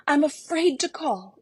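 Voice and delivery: high, loud panicked cry; terrified girl near tears; frightened gasp. Acted. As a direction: gasp it out in terror. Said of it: "she gasped".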